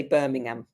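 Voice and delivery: "Birmingham" with no H, ending in a short "um"; British pronunciation. Shown as a demonstration. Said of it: "we don't pronounce the H".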